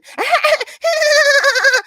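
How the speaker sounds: high, loud screechy cackle; mock dweeb laugh. Streamer impersonating a woman's laugh, screechy mock cackle. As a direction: imitate a geeky, screechy laugh. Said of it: "She laughs like this".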